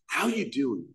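imitating an overfriendly greeter, fairly soft; mock in-your-face greeting. Imitating members greeting a newcomer, mock over-friendly. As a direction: mimic a pushy hello without shouting. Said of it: "like, in your face".

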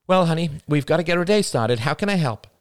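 cheerful but pushing; strained patience. A narrator's reading. Mother urging her son along, strained cheer. Mother pressing child kindly.

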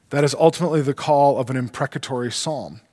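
ordinary talk, nothing marked

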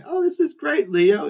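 put-on high-pitched gushing over a child's drawing; retold coo of approval, voice way up; delighted praise. Older sibling praising little brother's drawing, delighted.

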